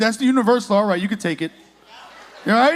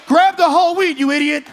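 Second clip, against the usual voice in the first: mock yell; 4 semitones higher, 5 dB louder than usual. TikTok kids yelling in store.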